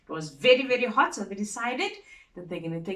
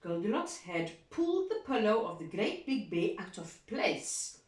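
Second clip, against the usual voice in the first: plain reading voice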